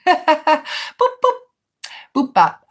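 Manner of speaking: high squeaky boop noises at the chat; bouncy little boop sounds, pitch jumping up; playful boops. Real, not acted. Streamer booping a viewer in greeting, playful high boops.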